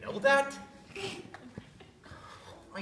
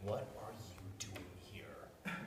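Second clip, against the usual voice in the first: whisper; 12 semitones lower, 18 dB quieter than usual. Acted. Secret visit, hushed alarm.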